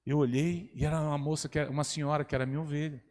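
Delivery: usual speaking voice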